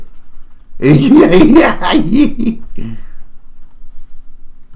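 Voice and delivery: high, wild cackle; long high cackling laugh; crazy prospector cackle. Acted. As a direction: cackle like a mad old miner.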